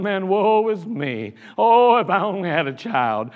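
mock self-pitying moan; mock woe. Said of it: "She didn't run around going".

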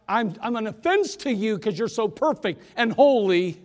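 building from plain to a high, loud plea; an acted sinner's prayer swelling into loud begging; desperate prayer. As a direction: cry out to God, rising to desperate pleading. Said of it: "You don't even have to say it like that".

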